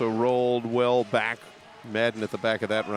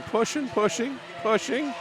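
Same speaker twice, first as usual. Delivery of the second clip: each "pushing" higher, climbing with suspense; rising play-by-play; 10 semitones higher than usual. Real, not acted. Commentator following Swanson pushing at the pack, rising suspense. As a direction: step the pitch up on every "pushing".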